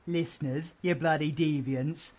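normal talking voice

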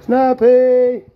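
calling out. Real, not acted. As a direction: call her loudly to come. Calling turtle to food.

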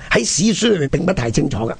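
ordinary delivery

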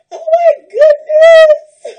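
high, loud laughing cry of joy; excited shriek. Real, not acted. Opening a gift from a friend, laughing squeal. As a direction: squeal it out, overwhelmed.

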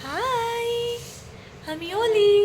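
put-on high squeaky baby voice; cute pet voice. Speaks as the pet Oli.

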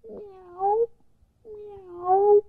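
high mewing as it walks; little mews in step; kitty meow. Acted.

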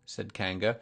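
ordinary delivery, nothing marked

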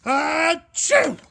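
explosive high, very loud acted achoo; huge mock sneeze. Bear's hunt for food interrupted, huge acted sneeze. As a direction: burst out with a storybook sneeze.

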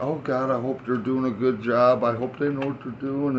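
imitating his worried mother, a touch anxious; mock nervous wreck of a mother. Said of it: "my mom's just a nervous wreck".

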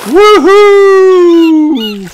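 very high, loud woohoo; long whoop riding a wave; joyful whoop. A narrator's reading. Children surf big bumpy waves, whooping.

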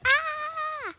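loud, high sustained scream; frightened scream. Real, not acted. Player screams on finding a body, frightened scream. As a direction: scream at the sudden horror.

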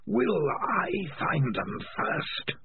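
slow, high eerie voice; creeping sinister whisper in the mind; alien voice. Acted. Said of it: "said an alien voice in Morris's head".

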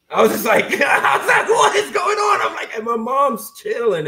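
squealing with giddy disbelief; excited laughing shout. Retold or imitated. Retelling shock of star's call.

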